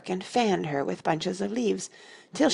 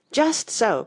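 high, loud agreement; triumphant royal exclamation; crying out; 7 semitones higher, 6 dB louder than usual. A narrator's reading. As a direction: cry out in emphatic agreement. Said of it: "cried the Red Queen".

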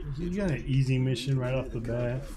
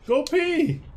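pitched-up, exclaimed order; high-pitched urging; 14 semitones higher than usual. Real, not acted. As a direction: send the friend off in a high voice. Answer to having to pee.

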